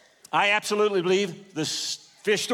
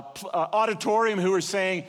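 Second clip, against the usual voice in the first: ordinary talk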